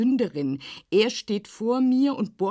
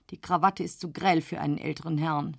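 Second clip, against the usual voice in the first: ordinary delivery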